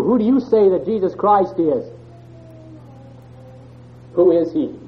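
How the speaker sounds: normal talking voice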